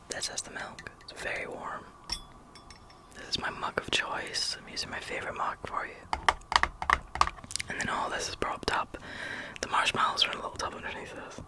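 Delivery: ordinary talk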